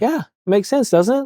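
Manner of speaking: high, bright-toned reassurance to a cop; reassuring. Retold or imitated. Therapist reassuring a relieved officer, high and gentle. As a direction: soothe him, high and gentle. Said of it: "I'm like".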